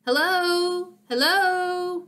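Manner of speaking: high, drawn-out walkie-talkie hello; talking into handset. A narrator's reading. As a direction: test whether the handset is working. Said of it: "spoke into her handset".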